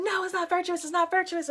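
high, protesting no, not virtuous; insistent protest. Retold or imitated. Retelling her upset protest to Miss Octavia, high insistent.

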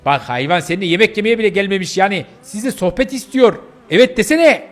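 heated, incredulous nagging; exasperated scolding. Acted. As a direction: press him in outrage to speak up. Friend scolds stammering Jake.

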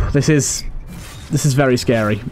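usual speaking voice

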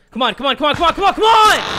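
frantic urging that climbs to a yell; excited urging building to a shout. Real, not acted. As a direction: egg it on harder and harder. Urging game shot.

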